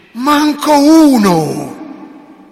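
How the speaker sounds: high, loud and very slow exclamation; loud drawn-out cry of a saint; exclaimed Francis. Acted. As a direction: Francis crying out, drawn out long. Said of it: "Francesco lo guarda e gli dice".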